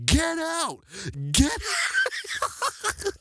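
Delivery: very high mock teacher yell; Mister Long. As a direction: squawk it like a comically outraged teacher, breaking into laughter. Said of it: "And then he'd be like".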